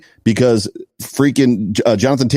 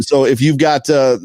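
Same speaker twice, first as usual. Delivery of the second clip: ordinary delivery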